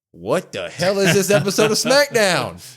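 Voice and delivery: high, loud disbelieving yelp; incredulous outburst. Retold or imitated. Podcaster recalling his shock at SmackDown, high incredulous yelp. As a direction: shriek it in mock disbelief. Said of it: "And I was like".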